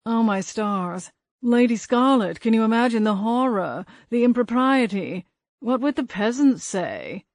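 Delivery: put-on overdone posh English accent, teasing the ghost; Hayward's English accent mocked. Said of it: "in his best impersonation of Hayward's accent".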